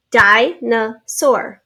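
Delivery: Dinosaur very slowly, as DI, NO, SAUR; slow three-beat sounding out; in syllables. Shown as a demonstration. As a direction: say Dinosaur with a gap after each syllable. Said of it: "Three syllables".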